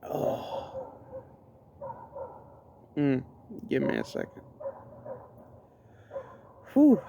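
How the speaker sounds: nose burning, catching breath after wasabi; gasping through wasabi burn; after eating wasabi. Real, not acted. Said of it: "my nose is burning".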